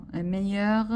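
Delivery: ordinary delivery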